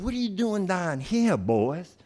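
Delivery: hammed-up Southern cop, pitched high; badly faked Southern lawman; bad Southern accent. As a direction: question them in a mock Southern accent. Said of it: "worst impression of a Southern accent".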